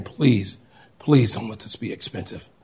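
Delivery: soft, pleading under the breath; a quiet desperate prayer; hushed prayer. Retold or imitated. Father praying at the price tag, soft and pleading. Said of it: "he is praying".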